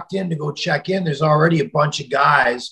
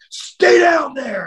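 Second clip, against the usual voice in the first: angry command; 13 semitones higher, slower than usual. Retold or imitated. Wrestler yelling at the caller before slamming the phone, furious. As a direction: order him to stay put, yelling.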